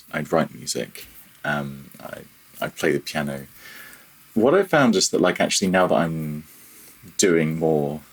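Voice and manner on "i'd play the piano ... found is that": normal talking voice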